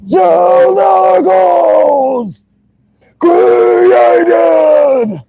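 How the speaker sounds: high distorted screamed vocals; singer impression. Imitating the band's singer for chat, high distorted scream. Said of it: "That's actually what he sounds like".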